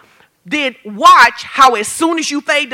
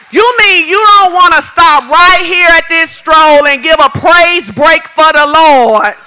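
fervent preaching; 4 semitones higher, 7 dB louder than usual. Real, not acted. Preaching with rising fervor.

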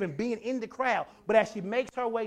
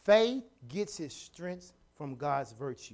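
slow dictation; 7 semitones lower, 3 dB quieter, slower than usual. Shown as a demonstration. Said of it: "Write that down".

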